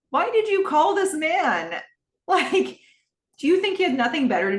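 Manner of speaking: real disbelief, voice shooting up; incredulous. Real, not acted. Reacting to Cam calling Tom, high incredulous outburst.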